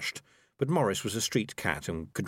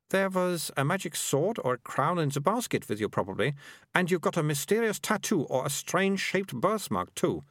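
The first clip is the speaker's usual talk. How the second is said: put-on higher, know-it-all young girl lecturing; a bossy girl explaining things; prim girl; 9 semitones higher than usual. Melissa insists on legend.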